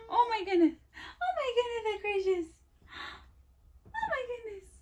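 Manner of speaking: a light soothing coo. Real, not acted. Mother soothing fussing baby.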